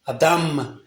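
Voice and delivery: "Adama" with an unstressed last A, falling; "Adama" without end stress; no final accent. Shown as a demonstration. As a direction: let the end of "Adama" drop away. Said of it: "es sin acento".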